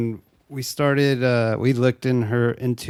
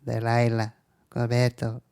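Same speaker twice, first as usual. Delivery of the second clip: imitating a girl's Spanish accent; mock Spanish-accented little girl; Laura. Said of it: "She had a fat ass accent".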